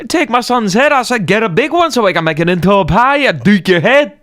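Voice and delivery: high, hammed-up Scottish accent; mock Scottish accent. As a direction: play a comic Scot, pitched high. Improvised Scottish character doing apple duking.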